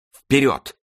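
high rousing cry; shouted command. A narrator's reading. The king, roused by the sight, shouts a high command. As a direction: shout the charge with fervour. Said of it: "крикнул тоже король".

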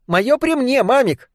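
high, chirpy game show contestant. A narrator's reading. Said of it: "чирикнула Триша голосом телеконкурсантки".

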